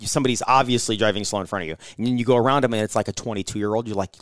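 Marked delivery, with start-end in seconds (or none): none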